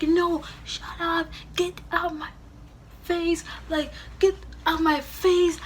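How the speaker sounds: high yelled refusal to calm down; going off. Retold or imitated. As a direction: tell them to back off, enraged. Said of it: "I'm just going off".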